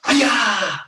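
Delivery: loud, high martial arts kiai; sharp karate-class battle cry; loud yell. Shown as a demonstration. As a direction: yell it out high, falling away at the end. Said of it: "loud yell".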